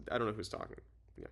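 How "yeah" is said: ordinary delivery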